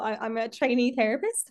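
ordinary talk, nothing marked

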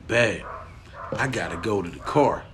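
low, soft imitation of a confiding partner; hushed retelling, voiced not whispered; retold whisper. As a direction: keep it down, low and quiet. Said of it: "We whisper to each other".

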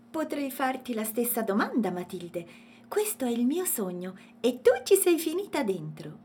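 high, lively character voice; cheerful little elephant. A narrator's reading. Elephant introduces himself to Matilde, cheerful. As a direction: introduce yourself in a high, friendly voice.

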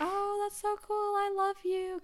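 very high, sweet mock gushing; gushing sister imitation. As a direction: imitate a supportive sister way up high, stretching the end. Imitating sister's supportive reply.

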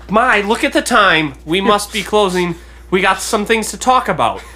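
high, hurried excuse to close; flustered auctioneer. Acted. Auctioneer closing the sale to talk, high-pitched brush-off.

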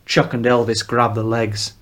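ordinary delivery

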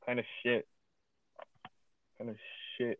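normal talking voice

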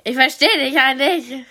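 can't hear herself talking; too loud, headphones on. Real, not acted.

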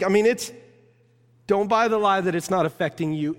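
ordinary talk, nothing marked

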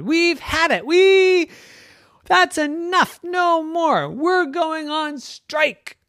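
squeaky high toy voice, fed up and defiant; tiny stuffed animal declaring a strike; high stuffy voice. Acted. Peluches en grève, voix aiguë.

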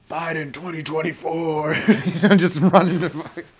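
slow words broken up by real laughter; laughing. Real, not acted. Comic laughing at his own joke to listeners, real laughter.

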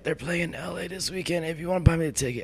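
high, quiet, wheedling plea; pleading with mom. Retold or imitated. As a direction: softly beg mom in a small high voice. Imitates own plea to mom.

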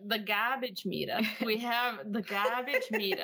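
thick Boston accent with dropped Rs; non-rhotic Boston, "gahbage meetah". Shown as a demonstration. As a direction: say "garbage meter" in full Boston. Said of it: "my voice sounds so different when I say it not in my accent".

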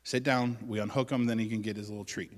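ordinary talk, nothing marked

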